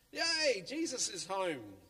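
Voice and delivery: high, loud cheer of joy; squealing, high-pitched cheer; excited yell. Acted. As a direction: shout it out like happy kids at the door. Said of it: "yelled his friends".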